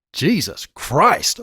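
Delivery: slow, drawn-out amazement; amazed. A narrator's reading. Man hears the teen's grim account, amazed. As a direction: say it slowly, in mild awe. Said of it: "I said in amazement".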